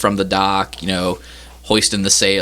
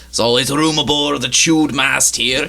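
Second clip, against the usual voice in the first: raised, lilting captain's voice; welcoming ship captain; 6 semitones higher than usual. Acted. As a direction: show off your boat as its captain. Captain repeats welcome in character.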